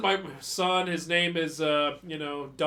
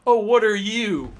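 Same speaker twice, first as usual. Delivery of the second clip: taunting; 3 semitones higher, 12 dB louder than usual. Retold or imitated. Kid taunting brother in story.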